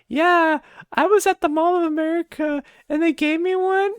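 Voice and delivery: high, put-on mocking sister voice. Vlogger mimicking sister bragging about free soda, high mocking voice. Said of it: "And then she's like".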